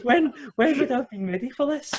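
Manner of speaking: high, laughing squeal of disbelief; retelling nervous panic, high and laughing. Retold or imitated. Retold panic before walking out.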